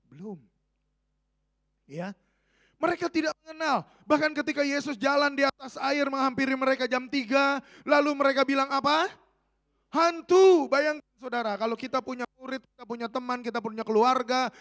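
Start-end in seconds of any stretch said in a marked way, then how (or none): 2.8-9.1 loud, raised preacher's delivery; impassioned preaching. Real, not acted. Preaches at full force. 9.9-10.7 loud, high acted shout; frightened disciples' cry. Lalu mereka bilang apa.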